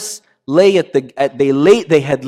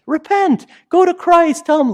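exhortation. Acted. Exhorting congregation.

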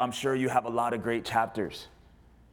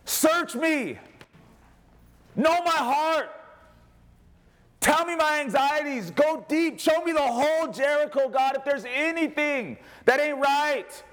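crying out to God; 12 semitones higher, slower than usual. Retold or imitated. Pastor voicing David crying out to God, impassioned. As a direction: cry out to God like a psalm. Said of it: "He's saying".

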